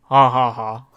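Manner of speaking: ordinary talk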